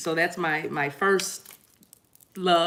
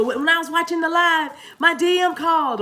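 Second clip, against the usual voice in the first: high, loud excited imitation; excited viewer; 11 semitones higher, 6 dB louder than usual. Viewer reporting back after the live, imitated excitedly. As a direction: voice a thrilled fan up high. Voices viewers' emails and comments.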